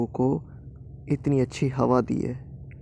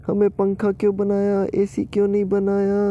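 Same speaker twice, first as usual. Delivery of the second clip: mock crying wail; 8 semitones higher, much slower than usual. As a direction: wail to God like a wronged child. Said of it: "रोना शुरू कर देते हैं". (नाटकीय रोते हुए विलाप)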